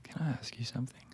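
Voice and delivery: fast, hushed nervous question; shy, whispered request to his date; quick whisper. Acted. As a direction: rush it out in a whisper.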